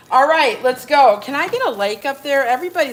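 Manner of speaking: big loud "let's go"; loud kickoff. Real, not acted. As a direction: shout it out to start the show. Kicking off the live.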